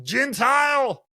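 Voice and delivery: loud, high, drawn-out exclamation. Acted. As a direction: cry it out slowly in a high put-on voice. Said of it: "that's the voice that I use".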